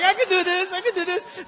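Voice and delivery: high, hyped-up self-assurance; acted bravado. Boy readies for the jump, high-pitched bravado. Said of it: "I was like".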